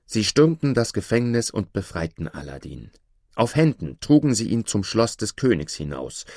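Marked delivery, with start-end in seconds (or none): none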